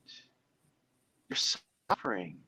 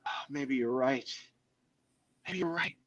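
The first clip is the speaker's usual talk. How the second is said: defeated; 3 semitones lower, 10 dB quieter than usual. Retold or imitated. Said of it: "we go".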